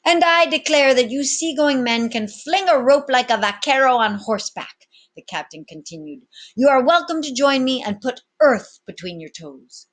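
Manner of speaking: loud, high swaggering captain; commanding captain. Acted. Captain addressing his pirates, loud and bold. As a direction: address the crew like an old sea dog.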